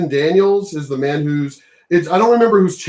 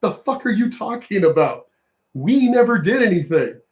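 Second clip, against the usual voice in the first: usual speaking voice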